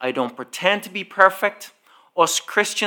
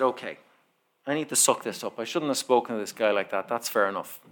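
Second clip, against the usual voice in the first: quiet self-talk; 6 semitones lower, 11 dB quieter, faster than usual. Retold or imitated. Talking himself down.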